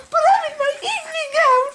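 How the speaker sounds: high, fast, half-sobbing laughing shout; laughing crying shout. Acted. [name] wailing at Matt about her evening gown. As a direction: wail about the gown while cracking up. Acted skit, fancy lady protesting.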